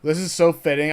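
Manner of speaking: ordinary delivery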